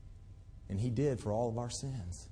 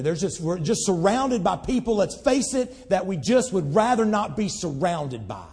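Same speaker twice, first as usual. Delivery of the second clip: full-force preaching; 7 semitones higher, 7 dB louder than usual. Acted.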